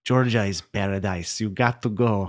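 slow, put-on Lebanese accent; badly imitated Lebanese accent, drawn out; Terry Puzelot. Friend urging him to visit Georgia, mock Lebanese accent. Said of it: "He doesn't sound anything like that".

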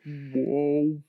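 low, drawn-out bass "whoa"; a bass singer's slow croon; deep bass singer. Retold or imitated. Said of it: "They'd be like".

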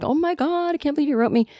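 high, breathless fan squeal; starstruck gush. Retold or imitated. Starstruck reaction to Norm's message.

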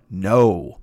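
low, blunt refusal; deadpan refusal. Retold or imitated. Actor reading a casting notice, deadpan refusal. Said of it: "I said out loud".

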